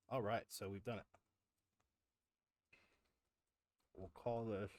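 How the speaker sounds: talking with a mouth full. Real, not acted. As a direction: speak through a mouthful of food. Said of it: "I really shouldn't talk with my mouth full".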